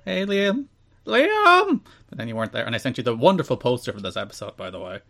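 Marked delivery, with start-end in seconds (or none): 0.1-0.7 rising call. Retold or imitated. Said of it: "and I'm like". 1.1-1.8 high, loud shout of the name. Retold or imitated. Host calling the sleeping co-host's name, loud high shout.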